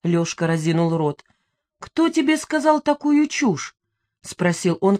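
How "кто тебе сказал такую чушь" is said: high-pitched, incredulous question; astonished. A narrator's reading. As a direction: ask in amazement, pitch held high. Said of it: "когда справился с изумлением".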